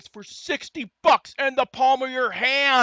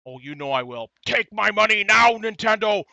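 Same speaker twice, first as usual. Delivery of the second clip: usual speaking voice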